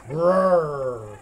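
long, rolling "grrr" growl; bear growl. Pretend. Quiz on bear sound.

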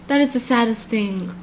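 ordinary delivery

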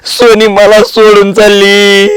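slow, tearful sob over lost love; crying. Acted. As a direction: choke the words out weeping, slowly. Drunk friend mourning lost love. (रडत रडत, हळू)

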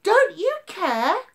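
high, loud pleading; panicked accusation; desperate cry. Acted. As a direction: beg him over the storm. Friends desperate in storm.

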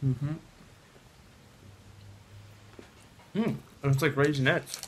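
chewing while talking; speaking between bites; mouth full of chocolate. Real, not acted. Seen 0: Man bit chocolate at -2, talks with mouth working afterward.

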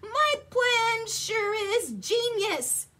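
high, loud, drawn-out bragging; boastful. A narrator's reading. Stu praises his own plan, loud boasting. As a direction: crow about your own brilliance. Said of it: "was not humble".

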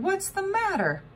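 high, concerned voice; worried question. A narrator's reading. Grandpa to his crying granddaughter, high and concerned. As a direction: check on the crying child.

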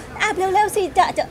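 put-on high, slow childlike pleading; mock child hurrying the nanny; child's voice. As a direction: plead like an impatient little kid.

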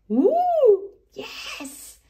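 high ooh, then a breathy whispered yes; thrilled but hushed; excited whisper. A narrator's reading. Peppa answering Grandpa in the dark garden, excited whisper. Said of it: "Peppa whispered back".

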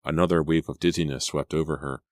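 ordinary delivery

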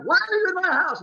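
angry demand. Retold or imitated. Homeowner to trespassing child, mock angry shout. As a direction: bark it at the intruder.

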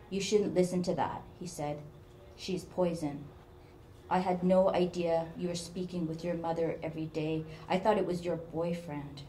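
ordinary delivery

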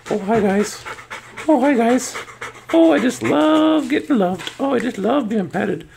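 high cutesy voice for the dog; dog's voice. Pretend. Owner voicing her dog greeting subscribers, high and cutesy. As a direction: talk as a happy pampered pup.

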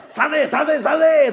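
high mock yell of the old man. Old man shouting back in the yelling match, high mock yell. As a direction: answer the rival's shouts. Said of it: "とかもう喧嘩やねん".

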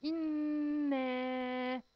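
إنا with the nun held in a long hum; إنا drawn out with a long nasal nun; long nasal ghunna. Shown as a demonstration. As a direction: stretch the doubled nun of إنا. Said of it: "حرف النون هنا عليه إيه؟ عليه شدة".

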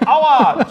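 sudden high, loud fake yelp of pain; mock ouch. Joking as the plank getting pinned, a loud mock yelp. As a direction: yelp as if something just got stuck into you.

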